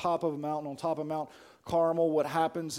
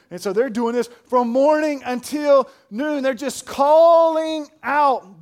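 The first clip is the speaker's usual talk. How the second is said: high, loud, drawn-out pulpit cry; full-force preaching; 9 semitones higher, 12 dB louder, slower than usual. Real, not acted. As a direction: stretch the words out as you build.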